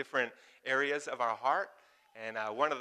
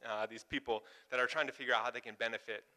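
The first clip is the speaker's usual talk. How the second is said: ordinary delivery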